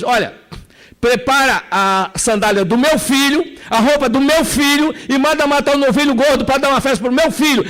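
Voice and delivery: the father orders the servants to honour his son, overjoyed; joyful father's orders. Acted. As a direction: call out for the feast like an overjoyed father.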